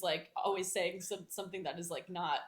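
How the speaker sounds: ordinary delivery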